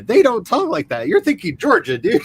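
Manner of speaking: high, incredulous mock scolding; exasperated, pitch leaping up; mock exasperation. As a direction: scold the absent actor in playful outrage.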